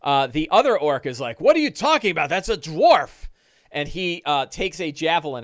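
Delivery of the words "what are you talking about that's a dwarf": surprised orc. Acted.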